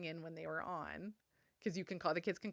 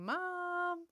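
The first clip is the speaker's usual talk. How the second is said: calling out Mom; 13 semitones higher than usual. Retold or imitated. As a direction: sing out Mom to get her attention. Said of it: "trick me by yelling".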